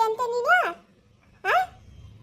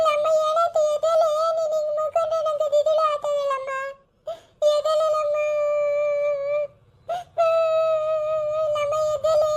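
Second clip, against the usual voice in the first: higher, crying plea to wake up; tearful pleading; 5 semitones higher than usual. Acted. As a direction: sob out her name, begging. Daughter wailing over collapsed mother.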